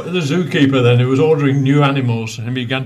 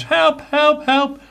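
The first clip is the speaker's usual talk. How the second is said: high-pitched mock cry for help; mock shout for help; 13 semitones higher, slower than usual. Actor in the lion's cage, mock shout for help. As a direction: cry out high without much extra volume. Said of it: "the actor shouts".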